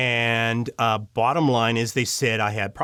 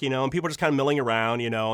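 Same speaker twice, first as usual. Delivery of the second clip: ordinary delivery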